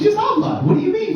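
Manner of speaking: high squeaky disbelief; incredulous squeak. Retold or imitated. Indignant retort to manager.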